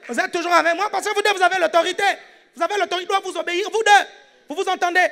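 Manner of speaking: high-pitched, insistent preacher's shout; fired-up preaching. Real, not acted. Preacher to congregation on shared authority over demons, fired-up. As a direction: drive the point home at high pitch.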